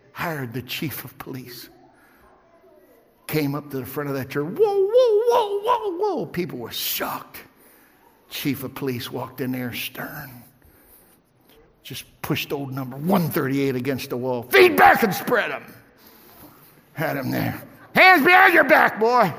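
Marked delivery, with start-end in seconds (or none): 4.5-6.3 high loud drawn-out whoas; mock crowd whoa. Preacher imitating the shocked congregation, high whoas. Crowd reaction to chief arriving. 14.4-15.6 loud barked cop order; police command. Retold or imitated. Chief of police ordering the man against the wall. 17.9-19.3 loud high shouted command; police shout. Retold or imitated. Chief of police shouting at the man he arrests.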